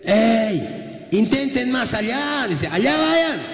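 high, carrying call across the water; preacher voicing the Lord calling from the shore. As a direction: call out to the fishermen in the boat.

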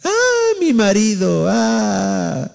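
imitating a wailing woman, high on the first Ah; hammed-up sobbing complaint; mock wail of a crying wife. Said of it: "Estás llorando".

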